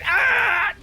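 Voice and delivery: high cut-off death cry; anime death scream. Retold or imitated. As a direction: scream like an exploding anime hero. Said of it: "like those fucking anime characters that die".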